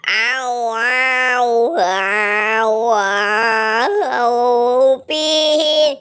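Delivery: drawn-out cartoon howls and moans; mock wailing.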